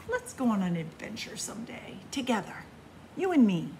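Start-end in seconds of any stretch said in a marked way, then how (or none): none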